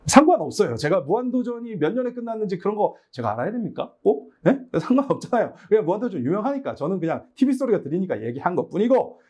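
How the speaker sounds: ordinary delivery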